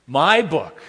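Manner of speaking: put-on grab everything like a two-year-old; mock toddler demanding "my"; possessive toddler. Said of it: "that's just me saying those things".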